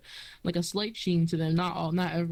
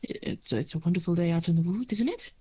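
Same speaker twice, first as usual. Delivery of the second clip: gentle forest elf. Acted. GM voices Silksong greeting.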